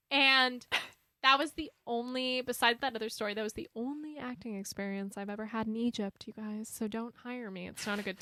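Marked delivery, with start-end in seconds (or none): none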